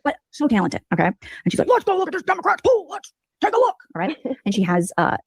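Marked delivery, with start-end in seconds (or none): 1.7-3.8 much higher, put-on gushing streamer voice; mocking a ranting YouTuber. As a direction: lampoon her cheery tour-guide tone. Voicing Farchado on her livestream.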